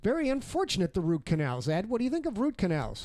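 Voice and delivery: lightly played late-night monologue; a hint of a talk-show host setting up the bit. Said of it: "the Johnny Carson show".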